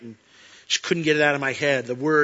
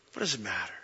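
gentle repeat; 5 semitones lower, 8 dB quieter, faster than usual. Retold or imitated. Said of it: "he's like".

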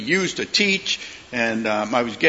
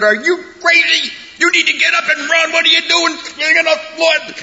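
much higher and louder, a hammed-up furious yell; mock scolding shout; 8 dB louder than usual. Father pretending to yell at his fallen toddler son, mock furious shout. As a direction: pretend to blow up at a toddler who fell, wagging a finger.

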